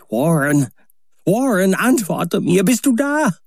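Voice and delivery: high, urgent calling into the phone; desperate shouting. A narrator's reading. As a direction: cry out for him again and again. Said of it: "rief und schrie ich".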